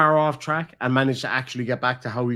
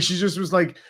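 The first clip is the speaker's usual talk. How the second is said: normal talking voice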